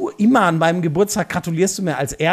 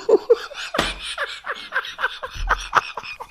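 burst of laughter. Real, not acted. Cracks up at insult joke.

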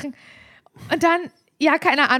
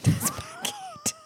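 much higher, soft, slow; incredulous caller; 10 dB quieter, much slower than usual. Retold or imitated. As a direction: squeak the question out in disbelief. Retold phone call, incredulous caller. (ungläubig, hoch gequietscht, leise und langsam)

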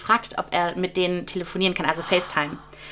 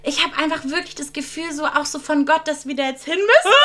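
higher, insistent mock plea; earnest pleading friend; 7 semitones higher than usual. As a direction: put it on thick, pleading it's from God. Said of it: "Sie so". (gespielt inständiges Flehen, höher)

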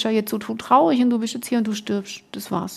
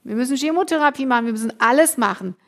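usual speaking voice

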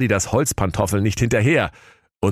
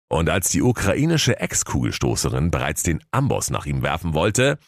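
plain reading voice

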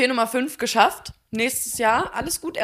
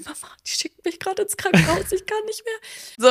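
retold crying; 11 semitones higher than usual. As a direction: sob it out like a desperate teen.

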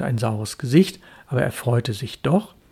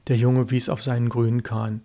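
plain reading voice

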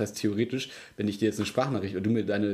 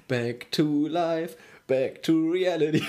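slow, high, stretched words; drawn out; 4 semitones higher, much slower than usual. Real, not acted. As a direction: stretch each word out slowly. Slow drawn-out song title.

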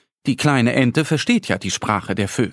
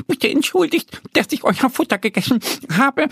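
much higher, sorry little squirrel voice; apologetic squirrel; 13 semitones higher than usual. A narrator's reading. Squirrel apologizes to the birds, high contrite voice. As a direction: say sorry sincerely, small voice. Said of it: "sagt das Eichhörnchen".

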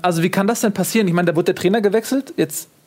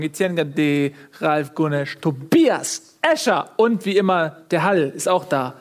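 usual speaking voice

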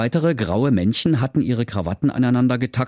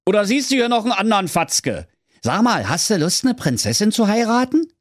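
very high, pushy little king; very high king voice calling a lad back; King Curry; 13 semitones higher than usual. Acted. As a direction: bark him to a halt like a squeaky king. King Curry offers a princess.

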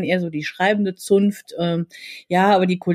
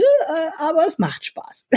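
laughing; 9 semitones higher, slower than usual. Real, not acted. Admits she enjoys it, giggling.

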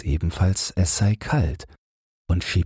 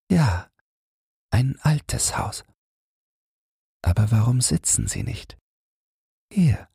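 higher woman's voice with a laughing lilt; amused young woman; 7 semitones higher than usual. A narrator's reading. Woman amused by the shy stranger, higher and light. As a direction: answer him lightly, teasing, voice lifted. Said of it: "Sie lacht, während sie sagt".

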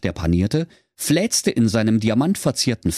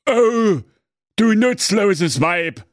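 slow, louder exasperated Saxon husband; 10 semitones higher, 3 dB louder, slower than usual. Acted. Husband Werner berating wife, slow exasperated groan. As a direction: groan it at your useless wife. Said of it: "sprach der Werner".

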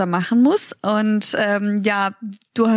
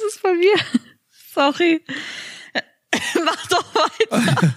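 high, breaking into giggles; can't stop laughing mid-sentence; giggling; 8 semitones higher than usual. Real, not acted. Giggling fit, throat trouble.

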